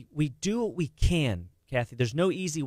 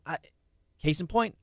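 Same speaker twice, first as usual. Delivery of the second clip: normal talking voice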